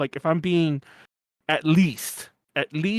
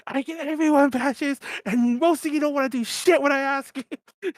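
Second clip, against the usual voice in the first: put-on higher drunk complaint; mock yelling; 8 semitones higher than usual. Drunk host yelling at friends about badges, mock angry. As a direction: rant half-hammed at the room. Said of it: "what I was yelling about".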